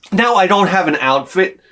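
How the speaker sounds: ordinary talk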